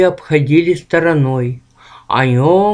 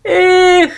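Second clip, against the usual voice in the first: apologetic moan; 13 semitones higher, 4 dB louder than usual. Acted. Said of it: "простонал, извиняясь".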